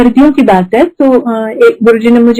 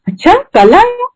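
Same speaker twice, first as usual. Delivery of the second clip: mock surprise; 10 semitones higher than usual. Guru teasing a false answer.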